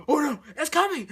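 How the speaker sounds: loud, high warning; sudden mock panic; alarmed cry. She spots the wave coming, alarmed cry.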